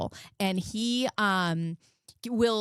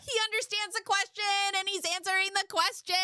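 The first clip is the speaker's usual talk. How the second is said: much higher, thrilled proud-mom cry; overjoyed shout; 13 semitones higher than usual. Real, not acted. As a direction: burst out with real excitement.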